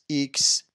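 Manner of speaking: "ایکس" with a long ee, eeks; wrong pronunciation. Shown as a demonstration. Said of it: "اشتباه هست".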